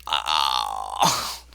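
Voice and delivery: deep dazed oh, falling back; dazed low groan. Acted. Foxy falls back dazed.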